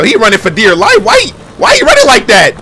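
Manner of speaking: loud, climbing to very high; amused disbelief. Real, not acted. Watcher seeing him run for dear life, incredulous shout.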